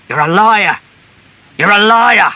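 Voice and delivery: high, very loud, escalating accusation; enraged husband at full pitch; furious shouting. A narrator's reading. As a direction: shout it harder each time. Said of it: "he shouted".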